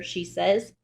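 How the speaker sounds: usual speaking voice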